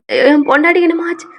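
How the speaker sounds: fast, loud, high cry; acted husband's panic; panicked. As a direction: ask in frantic alarm. Husband panics over fainted wife.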